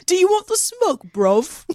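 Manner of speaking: mock London street challenge, starting high; modern British slang accent. Joking with co-host, modern British street accent. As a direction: put on a cheeky British lad's accent. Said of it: "that's the modern equivalent of".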